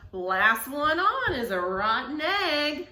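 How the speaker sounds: drawn-out cheeky hen; chicken's thought. Acted. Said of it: "what she thought was".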